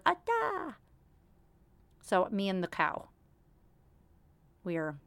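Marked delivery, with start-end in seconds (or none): 0.1-0.8 put-on very high baby babble; mock baby calling the toy cow; imitating toddler. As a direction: coo it like a toddler naming the cow. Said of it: "He goes".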